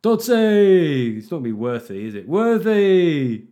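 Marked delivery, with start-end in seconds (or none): 0.0-1.2 loud, high, stretched-out holler; mock nickname cheer. Cheers an invented nickname again. 2.3-3.4 high, loud, drawn-out yell; big laddish shout; mock nickname cheer. Tries another nickname as a cheer.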